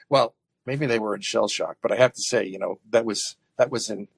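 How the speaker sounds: ordinary talk, nothing marked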